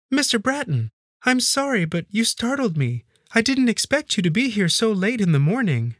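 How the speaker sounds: high-pitched maid's voice, apologetic; startled maid. Acted. Maid caught off guard by her employer, high and startled.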